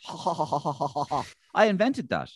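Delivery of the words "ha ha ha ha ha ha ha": exaggerated high-pitched chuckle; put-on caricature laugh in a string of ha's; Roy Keane. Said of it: "Roy Keane never goes".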